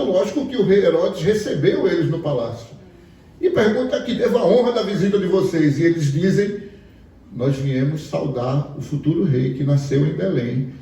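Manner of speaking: ordinary talk, nothing marked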